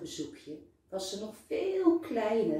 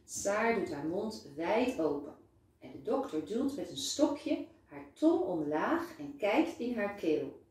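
ordinary delivery, nothing marked

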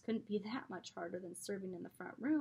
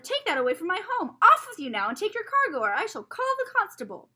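high, loud furious threat; furious shout; 11 semitones higher, 16 dB louder than usual. A narrator's reading. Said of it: "he shouted".

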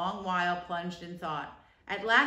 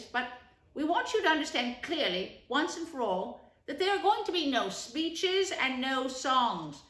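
higher, louder stern lecture; firm rat; 3 semitones higher, 4 dB louder than usual. Acted. Rat tells Toad there will be no speeches, firm. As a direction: lay down the law kindly but firmly.

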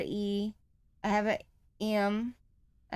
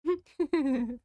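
higher-pitched chuckle; laugh; 6 semitones higher, 3 dB louder than usual. Real, not acted. Player caught by what was after her, chuckling.

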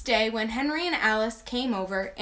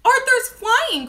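high, loud, drawn-out joyful call; happy cry; 11 semitones higher, 7 dB louder, slower than usual. A narrator's reading. Henry delighted the butterfly flies, happy cry. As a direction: exclaim it with delight, pitched up high. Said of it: "cried Henry happily".

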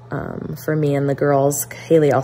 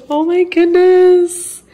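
much higher, drawn out; delighted gasp; 13 semitones higher, 5 dB louder, slower than usual. Real, not acted. Sister sends a wedding save the date, delighted surprise. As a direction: gasp with joy.

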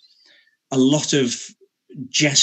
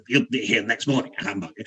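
harsh Scrooge; 3 dB quieter than usual. Shown as a demonstration. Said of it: "Scrooge was very harsh".

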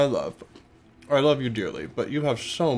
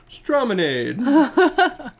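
drawn-out high; 12 semitones higher, 6 dB louder, much slower than usual. Real, not acted. Savoring silly nickname.

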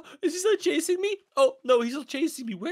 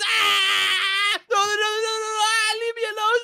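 panicked higher-pitched yelling; scared screaming; 4 semitones higher than usual. Real, not acted. Boy caught by a tiger in his base, scared screaming. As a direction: scream in fright and beg it off. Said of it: "He actually screamed".

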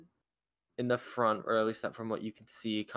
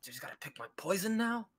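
soft, higher-pitched muttered question; faint mumble; 8 semitones higher, 5 dB quieter than usual. Acted. Griffin not really listening, faintly mumbling to itself. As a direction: mumble it softly to yourself, rising. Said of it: "you can hear out a faint mumble".

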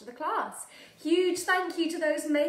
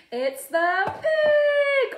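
excited announcing; 10 semitones higher, much slower than usual. Shown as a demonstration. Animal song for toddlers.

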